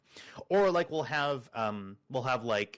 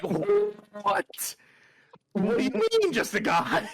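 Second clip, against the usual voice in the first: very high squeal of mock disbelief; hammed-up shocked questioning; flabbergasted; 3 dB louder, much slower than usual. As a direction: squawk it, utterly baffled. Shocked reply, acted out.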